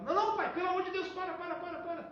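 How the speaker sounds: high, loud pleading "não, não, pai"; panicked begging. Retold or imitated. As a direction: beg him to stop in a rush of panic. Son begs father to stop.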